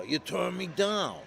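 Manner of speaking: high, slow Jersey caller voice; Jersey tough guy. Retold or imitated. Jersey guy phoning the illustrator who refused him, mock tough. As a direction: put on a Jersey mobster's drawn-out accusation. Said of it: "a guy from Jersey calls me".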